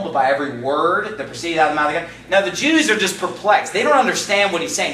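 ordinary delivery

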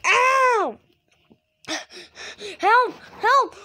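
high panicked yells; screaming for help. Acted. Victim screaming during attack.

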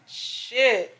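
ordinary talk

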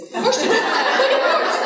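very high, laughing exasperation; high-pitched, giggly sarcasm; sarcastic disbelief. Retold or imitated. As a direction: squeal it in mock resignation. Exasperated reaction to caller's mistake.

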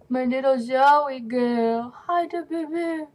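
high cooing voice for a cat. Real, not acted. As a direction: coo at the kitty. Talking sweetly to her cat.

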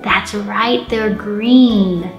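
usual speaking voice

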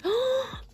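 sharp excited gasp. Real, not acted. Spots Boba in freebies.

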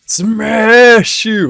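high, loud heroic battle cry; big drawn-out shout; All Might. Acted. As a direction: belt it out like a superhero attack. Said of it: "was that an All Might reference".

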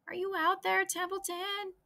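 high piglet voice calling across the yard. Acted. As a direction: call out, searching for a friend, high. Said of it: "called Wilbur".

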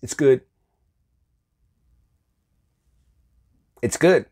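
low, offhand, barely interested; flat lukewarm reply. Retold or imitated. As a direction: give a dismissive minimum. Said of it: "And he says".

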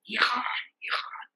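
imitating a soft urgent whisper; hushed warning. French guy secretly warning the speaker, hushed whisper. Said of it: "Can you speak louder?".

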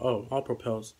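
ordinary talk

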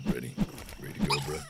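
normal talking voice

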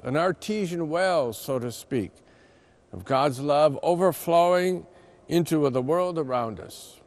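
normal talking voice

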